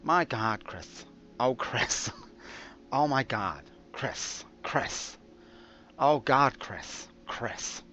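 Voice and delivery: hammed-up swooning partner, names half whispered; mock-passionate gasps, dropping to whisper; Sandy. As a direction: swoon over the name in a put-on intimate voice. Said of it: "I know she doesn't talk like that".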